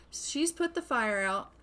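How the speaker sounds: ordinary delivery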